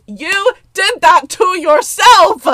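high, loud, slow yell; outraged yell. Real, not acted. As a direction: shout it slowly at the villain. Yelling at the villain.

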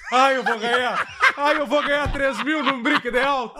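high, drawn-out mock glee; singsong gloat, stretching the words; gloating dreamer. Co-host teased about dreaming of his profit, mock gloating. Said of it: "tu vai dormir assim".

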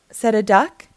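ordinary delivery, nothing marked

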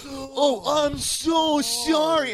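high, slow, mock sorry; sarcastic apology. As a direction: drip with fake regret. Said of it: "he's sorry very sarcastically".

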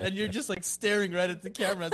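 ordinary talk

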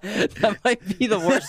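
high, slow words through laughter; laughing hard. Real, not acted. Host mocking his own terrible year guess, laughing hard. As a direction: crack up while saying it.

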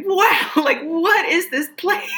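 high, laughing wow of wonder; amazed wonder. Retold or imitated. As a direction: marvel at it, half laughing. Amazed in the dream desert.

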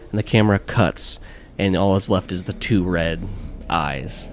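ordinary talk

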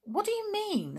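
high-pitched surprised question. A narrator's reading. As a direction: ask it in startled surprise. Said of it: "said Julian in surprise".

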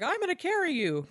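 high-pitched mock-heroic quote; Sam. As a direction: pitch it way up for the hero's vow. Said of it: "And so Sam's like".

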